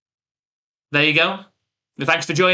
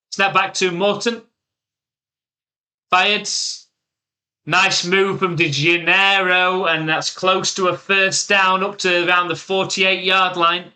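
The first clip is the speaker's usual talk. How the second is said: brisk, raised sportscaster call; play-by-play call; 4 semitones higher, slower than usual. Acted. Streamer calling his game's play to chat, sportscaster style. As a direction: call the snap and run like a broadcaster.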